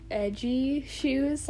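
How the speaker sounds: ordinary delivery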